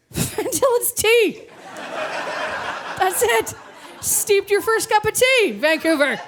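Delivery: high, drawn-out hammed-up excitement; sarcastic over-the-top triumph; mock amazed reveal. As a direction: announce it as a mind-blowing revelation. Mock amazed reveal of steeped tea.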